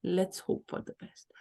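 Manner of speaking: soft whisper of hope; gentle whispered comfort. Shown as a demonstration. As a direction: breathe the words softly, unvoiced. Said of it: "Use the word".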